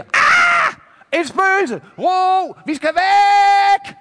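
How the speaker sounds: high, loud panicked shrieking; frantic shouting, final word drawn out; terrified scream. Acted. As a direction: scream in terror at a ghost. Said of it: "så de skriger".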